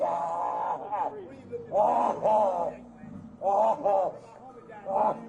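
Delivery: strained cries of real pain; real pained groaning; pained groans. Real, not acted. Pained cries while restrained.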